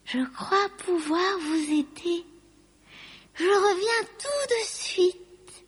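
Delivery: high, slow dragon-aunt voice; drawn-out high-pitched reassurance; Lucie, helpful. A narrator's reading. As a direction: offer help slowly in a high voice. Lucie offers the elves her help.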